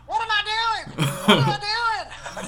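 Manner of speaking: high, loud, drawn-out bewildered cry; imitating the inbred twin, screaming slowly; slow panicked shout. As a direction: stretch out a confused shriek. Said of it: "Sometimes I was like".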